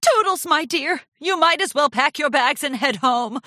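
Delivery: theatrical tearful goodbye; sniffy farewell. A narrator's reading. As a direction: send her away like a wounded martyr. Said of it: "Rarity sniffed".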